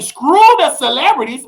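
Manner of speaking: ordinary talk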